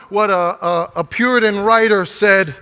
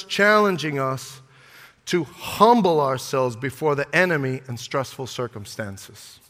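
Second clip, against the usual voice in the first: ordinary talk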